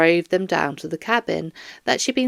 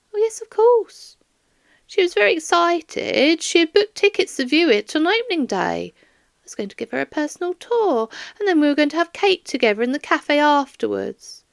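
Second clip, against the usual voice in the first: higher, fond grandmother voice; doting old lady; 8 semitones higher than usual. Acted. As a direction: gush about her granddaughter. Doris fondly describes plans.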